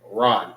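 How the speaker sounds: "run" said as RAHN, open ah; "run" with the Hebrew ah vowel; correct pronunciation. Shown as a demonstration. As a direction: say "run" like "Ron". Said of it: "Not ran".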